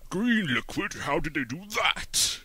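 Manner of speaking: booming high excited commentary; Brian Blessed impression. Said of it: "He is obsessed with it".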